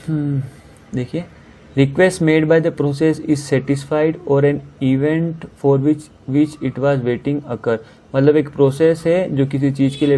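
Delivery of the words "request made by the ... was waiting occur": slowly reading out each word of the question; slow, deliberate question reading; slow read-aloud. Shown as a demonstration. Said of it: "rereads the question".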